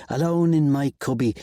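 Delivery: usual speaking voice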